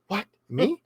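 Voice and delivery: high, gasping mock surprise; mock shock. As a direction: act out a shocked gasp, lightly. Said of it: "Nielsen reacted in shock".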